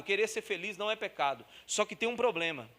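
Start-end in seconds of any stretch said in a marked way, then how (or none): none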